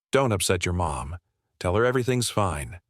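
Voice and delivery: ordinary delivery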